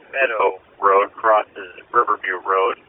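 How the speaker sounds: ordinary delivery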